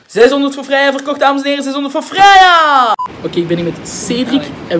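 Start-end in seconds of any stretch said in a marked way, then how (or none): 0.1-1.6 auctioneer closing the sale. Acted. Auction bidding at Monopoly. 1.6-2.9 slow, loud auctioneer call; auctioneer shout. Acted. Auction bidding at Monopoly.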